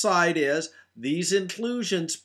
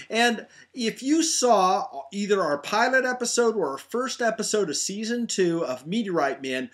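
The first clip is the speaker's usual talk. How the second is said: ordinary talk, nothing marked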